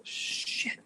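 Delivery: unvoiced hushed swear; whispered curse. Real, not acted. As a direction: breathe the curse out. Battery dying panic.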